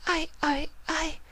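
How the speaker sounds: very high, faltering and soft; stuck for words, caught out; panicked stammer. Acted. Rarity caught by Pinkie, high faltering stammer.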